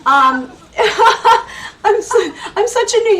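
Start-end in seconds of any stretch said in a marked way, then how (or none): 0.8-1.8 a high, loud giggle; giggling laugh. Real, not acted. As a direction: laugh with delight at the accent. Host laughs about accent.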